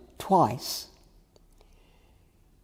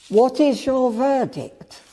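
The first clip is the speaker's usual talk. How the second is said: high, raised-voice demand; pitched-up, forceful question; 8 semitones higher, 4 dB louder than usual. A narrator's reading. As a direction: call for the verdict from the whole council. High priest demands the council's verdict.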